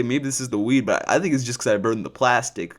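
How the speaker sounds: ordinary talk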